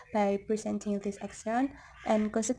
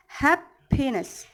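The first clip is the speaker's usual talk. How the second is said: slow "happiness" broken after a high "hap"; stress on first syllable; 3 semitones higher, slower than usual. Shown as a demonstration. Teacher repeating example, slow "happiness" with stress on "hap". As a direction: hit "hap", pause, drop through "piness". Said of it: "the stressed syllable is in hap".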